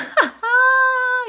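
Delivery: cackling laugh at her own card; loud high laugh. Real, not acted.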